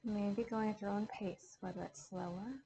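calm yoga teacher counting out a box breath; guided meditation. Acted. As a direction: talk the class gently through each breath.

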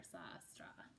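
breathy whispered letdown; soft storyteller whisper. A narrator's reading. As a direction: drop to a hush. Narrator hushes the letdown.

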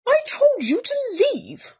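high, exasperated cry. A narrator's reading. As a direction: shout at an unwanted guest. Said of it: "cried Bear".

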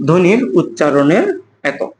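slow, word-by-word dictation. Shown as a demonstration. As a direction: spell it out slowly for note-taking. L'enseignant dicte la définition.

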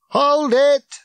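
loud, high-pitched command; shouted as through a megaphone; megaphone bark. A narrator's reading. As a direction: bellow it to halt a crowd. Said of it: "A megaphone barked from a taxi".

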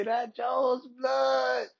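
high, drawn-out triumphant shout; slow victory shout. Pretend. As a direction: stretch it out like a post-fight roar. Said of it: "I can't wait to get a win and say".

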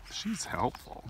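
usual speaking voice

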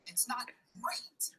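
slow, hushed whispered aside. Pretend. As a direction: whisper it as a mock secret. Confidential aside about own review.

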